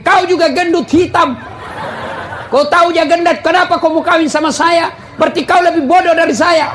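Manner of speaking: a touch of a bickering wife; mock quarrel. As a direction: taunt the husband in a mock fight. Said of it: "Nabi bilang juga istrinya".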